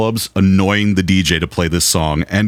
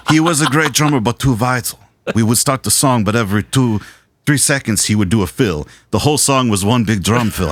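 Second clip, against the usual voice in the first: hammy accented producer impression, pitched up at first; [name]; 3 semitones higher than usual. Producer complaining about a fill-happy drummer, put-on accented voice. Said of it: "should I do the [name] voice?".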